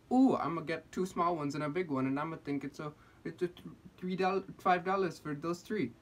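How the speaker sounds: badly faked Swedish accent; mock Swedish voice. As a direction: imitate a Swedish shopper, badly. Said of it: "making stuff up as I go with the voice".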